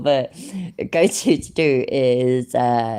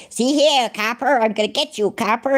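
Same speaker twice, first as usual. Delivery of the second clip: high-pitched mock movie gangster; old gangster-film voice; 8 semitones higher than usual. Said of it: "in them old gangster films".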